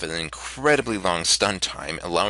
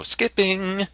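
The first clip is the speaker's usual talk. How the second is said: sing-song; 11 semitones higher than usual. Real, not acted. Dismissive skip announcement.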